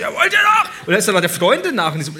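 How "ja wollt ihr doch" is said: much higher and louder, a picking-a-fight yell; hammed-up taxi-queue brawler; mock aggressive yell. Imitating the rude man at the taxi queue, high and loud. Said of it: "Und er so".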